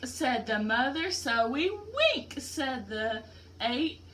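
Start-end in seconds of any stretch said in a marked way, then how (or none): 1.1-2.4 higher, slow little owl voices. Acted. Baby owls answer their mother, high and slow.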